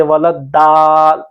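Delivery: वाला दाल with a light, plain dental "d" and open "aa"; unrounded everyday "daal" instead of heavy "daw"; wrong thin Dad. Shown as a demonstration. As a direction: show the mistake, दाल said thin. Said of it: "इसको यूं नहीं पढ़ सकते".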